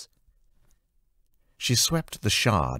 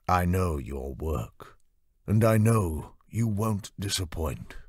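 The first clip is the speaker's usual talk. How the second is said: slow, lightly lowered smooth male voice; a hint of a smug patron; 3 semitones higher than usual. Lord Dressen startling Anna from behind, slow and smug.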